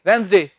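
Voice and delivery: वेडनेसडे as quick wenz-de, short final vowel; abrupt wrong ending. Shown as a demonstration. Teacher shows what not to say, वेडनेसडे with abrupt short de. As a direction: cut वेडनेसडे off at de, no glide. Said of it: "अंग्रेज कभी नहीं बोलेंगे".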